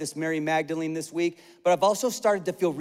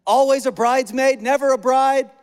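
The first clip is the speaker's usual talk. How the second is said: mock wail; 5 semitones higher, 5 dB louder than usual. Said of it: "been like".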